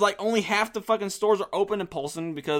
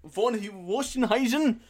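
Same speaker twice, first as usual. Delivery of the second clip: mock German; 4 semitones higher, slower than usual.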